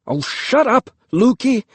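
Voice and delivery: a brother snapping at his sister; annoyed. A narrator's reading.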